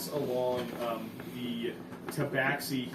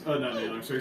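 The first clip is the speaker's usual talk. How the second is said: ordinary talk, nothing marked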